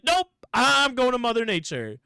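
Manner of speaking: high, loud defiant refusal; defiant nope. Retold or imitated. Mrs Claus refusing the brothers, loud and defiant. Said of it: "She's like".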